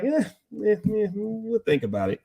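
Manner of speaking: mock-reluctant, high hemming and hawing; noncommittal brush-off. Warner Brothers answering a plea to revive the show, noncommittal. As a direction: brush them off with a high, dismissive "eh".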